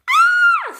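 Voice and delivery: very high, loud piercing scream. Acted. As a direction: shriek in panic as the coaches fly off. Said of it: "screamed Mavis".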